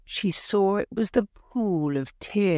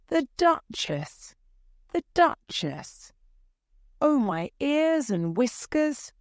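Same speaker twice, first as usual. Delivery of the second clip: high-pitched, mildly panicky rabbit; a touch of dread, pitched high; fretful rabbit; 9 semitones higher than usual. A narrator's reading. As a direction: fret aloud in a raised pitch.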